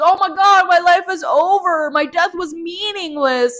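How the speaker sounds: high, loud melodramatic whine; mock Alice wailing that her life is over; whiny Alice. Vlogger mocks Alice's self-pity, high melodramatic whine. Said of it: "she's like".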